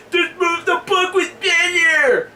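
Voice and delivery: high, slow whiny shout; hammed-up nasal-free geek whine, shouted high; griping geek. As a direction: squeal it like a nerd at the back of the theater. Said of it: "pencil neck geeks ... griping about it, like".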